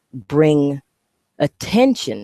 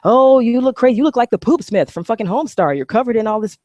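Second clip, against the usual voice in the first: mocking onlooker; 8 semitones higher, 4 dB louder than usual. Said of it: "I don't care".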